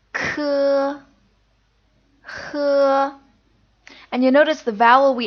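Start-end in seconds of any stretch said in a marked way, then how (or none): 0.1-3.1 K with a puff of air, H from the back of the throat; K aspirated, H as a throaty rasp; Mandarin initials. Shown as a demonstration. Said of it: "This is the next set of initials".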